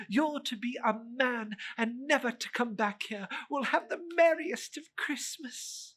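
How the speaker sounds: put-on high, eager child's voice; a little sister bursting with joy; little girl calling her brother home, high and excited. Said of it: "came darting in".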